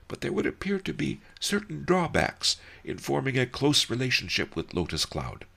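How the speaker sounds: high, dry teasing voice, "Woofy" with a mocking rise; an amused old master needling his friend; sly old sage. A narrator's reading. Master Li teases the lovesick ox, dry and amused.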